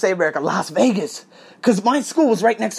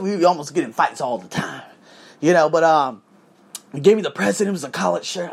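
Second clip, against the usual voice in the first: ordinary delivery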